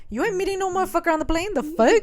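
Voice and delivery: fast, high, indignant rant; mock jealous outrage. Boyfriend explaining the empty plane, mock jealous outrage. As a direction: rattle it off in hammed-up possessive disbelief.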